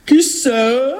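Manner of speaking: odd, drawn-out disguised ogress question. Acted. Ogress posing as grandmother at a knock, odd voice. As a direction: call out in a funny ogress voice. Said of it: "Tu as une drôle de voix".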